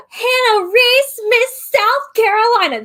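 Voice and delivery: pageant yell. Retold or imitated. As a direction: belt it like a pageant host. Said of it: "They yell into that stuff".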